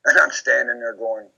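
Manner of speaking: ordinary talk, nothing marked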